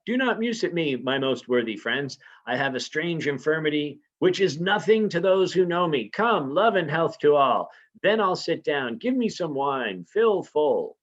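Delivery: a lightly voiced king covering his strangeness; Macbeth's forced cheer. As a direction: play a host faking good cheer. Teacher reads Macbeth covering up.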